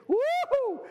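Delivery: very high mock ghostly "woo"; long high-pitched ghost hoot; spooky ghost wail. Tevye imagines an evil spirit.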